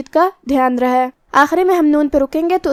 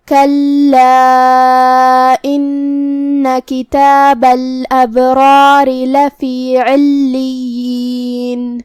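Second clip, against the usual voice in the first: slow melodic chanted recitation with long held vowels; Quranic recitation; much slower than usual. Shown as a demonstration. Teacher recites the verse for students to follow. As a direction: recite the verse slowly in tajweed style.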